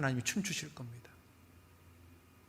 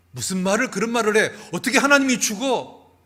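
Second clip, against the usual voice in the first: much higher, loud outraged cry; shocked shout; 9 semitones higher, 13 dB louder than usual. Retold or imitated. Luther shouting back at his wife in outrage. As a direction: blurt it out in loud disbelief.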